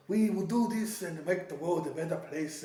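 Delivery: lightly put-on German accent; a hint of mock German speechmaker; Klaus Schwab. Voicing the forum leader's promise, light German accent imitation. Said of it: "But he's trying to say".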